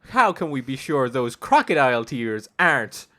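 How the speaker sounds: deliberate, dramatic mock accusation; courtroom lawyer. Steve Irwin's lawyer accusing the crocodile, theatrical questioning. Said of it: "Steve Irwin's lawyer. He's like".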